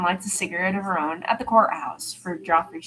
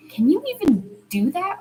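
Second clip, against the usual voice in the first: high, incredulous rising question; surprised question; 11 semitones higher, 5 dB louder than usual. Acted. Arya asking about Sam, in disbelief. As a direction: sound amazed he could skip it.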